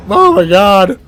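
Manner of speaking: loud, high-pitched anxious whine; imitated squeaky worried sidekick, loud; panicky sidekick impression. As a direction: cry out like a nervous cartoon boy doubting the route. Acted Morty line addressed to Rick.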